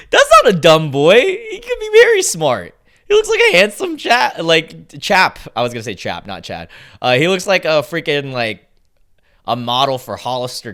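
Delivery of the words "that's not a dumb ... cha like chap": excited protest. Real, not acted. Reacting to AI's dumb boy.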